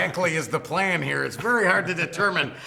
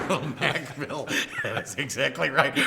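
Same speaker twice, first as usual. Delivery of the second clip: laughing; 5 semitones lower, 3 dB quieter than usual. Real, not acted. Laughing at a co-host's joke.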